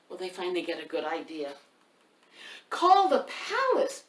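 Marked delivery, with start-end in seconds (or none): 2.7-4.0 high, slow, loud call. Acted. Townsfolk call out for help.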